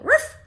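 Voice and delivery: high, loud yappy ruff; dog bark. Acted. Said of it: "a dog barking".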